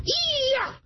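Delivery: very high kiai yell; mock karate shout; karate cry. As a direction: shriek a martial arts cry. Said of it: "se pone en posición de ataque".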